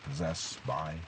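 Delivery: normal talking voice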